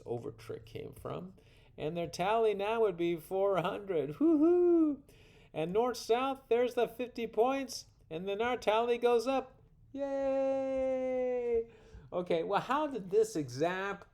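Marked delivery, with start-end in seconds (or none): 4.2-5.0 high, loud woo-hoo; celebratory whoop. Real, not acted. Celebrating a higher score. 9.9-11.6 high, long-held yay; drawn-out cheer. Real, not acted. Cheering the tally going up.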